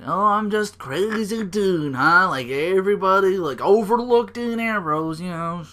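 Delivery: lightly voiced bitter wrestler; mocking Dean. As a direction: whine about being overlooked. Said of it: "I was like".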